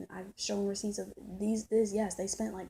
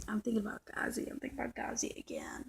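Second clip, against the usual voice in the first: whisper; 6 dB quieter than usual. Real, not acted. Private aside.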